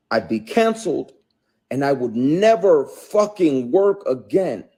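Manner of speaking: loud, high, indignant; real anger at a coworker's harassment, loud and high; heated rant. Real, not acted. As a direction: let the outrage build as he vents. Angry rant about harassment.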